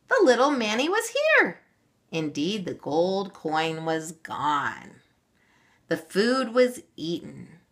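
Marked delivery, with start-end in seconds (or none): 0.1-1.6 crowing. A narrator's reading. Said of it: "crowed Auntie Moira".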